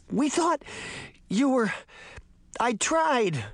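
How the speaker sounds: high, broken-off flustered stammer. A narrator's reading. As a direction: stumble over the words, flustered. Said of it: "Sabrina stammered".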